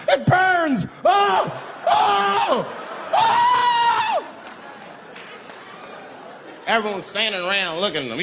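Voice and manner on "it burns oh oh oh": high, loud agonized shrieks; freaking-out screams. Acted. Unlucky man hit behind the comic, freaked-out screaming. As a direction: scream it, climbing higher each cry. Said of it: "This guy was freaking out".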